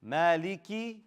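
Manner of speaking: "مالكي" ending on a full "ee"; clear kasra. Shown as a demonstration. Teacher models for students, kasra clearly shown. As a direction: sound out the last vowel of "مالكي". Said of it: "Show that كسرة".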